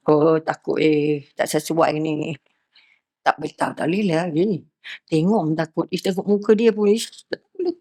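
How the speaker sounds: hushed, wary muttering to oneself; fearful inner voice. Pretend. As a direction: voice the gut's nervous judgement under your breath. Judging a stranger's face, inner voice. (suara hati yang takut, perlahan)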